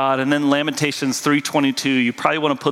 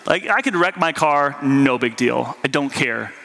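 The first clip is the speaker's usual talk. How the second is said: ordinary talk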